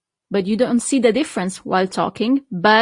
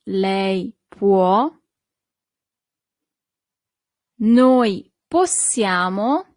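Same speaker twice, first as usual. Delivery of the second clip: slow, deliberate teacher pronunciation, pausing between persons; slow model conjugation; slower than usual. Shown as a demonstration. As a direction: say each form slowly for learners to copy. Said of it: "let's see its conjugation".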